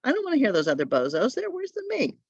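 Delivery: high, put-upon voice of an impatient classmate; mock student grumble. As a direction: sneer at the other readers like a bored language student. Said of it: "you're like".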